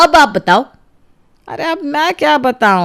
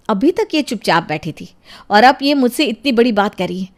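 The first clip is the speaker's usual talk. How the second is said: ordinary talk, nothing marked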